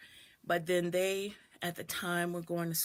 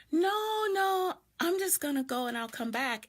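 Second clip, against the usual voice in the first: higher, urgent no, no; retold frightened refusal, higher; anxious refusal; 10 semitones higher than usual. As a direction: push out the refusal to get away. Refusing the man at door.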